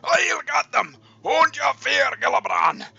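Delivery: loud, high battle cry; delirious shout. Acted. Delirious dwarf rallying his lord, high loud shout. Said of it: "starts to shout".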